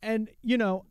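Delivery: high, slow, forced cheer; fake cheerful. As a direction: sell it with a too-bright grin. Ironic everything-is-fine bit.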